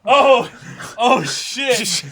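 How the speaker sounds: high, loud and slow with laughter; gleeful shouting of triumph; ecstatic yelling. Real, not acted. Proud of roasting the villain, yelling in laughing triumph. Overjoyed over being proven right.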